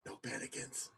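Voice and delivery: whispered no panicants. Pretend. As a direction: hush it out in a whisper. Whispered echo of the joke.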